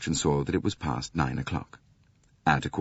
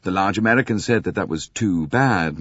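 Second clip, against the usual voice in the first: plain reading voice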